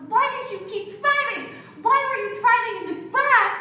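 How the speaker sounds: a hint of a rattled mother; upset mom. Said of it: "she goes".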